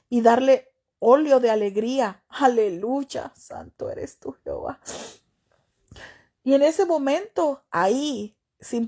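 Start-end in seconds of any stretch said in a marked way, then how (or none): 3.4-4.8 whispered prayer. Real, not acted. Preacher breaks off to whisper praise to God. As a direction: hush the voice down into a private prayer.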